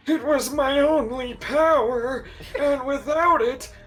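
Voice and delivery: high, hammy Magneto impression. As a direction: quote him high and dramatic. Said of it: "one of my favorite lines is just like".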